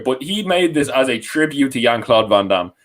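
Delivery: ordinary talk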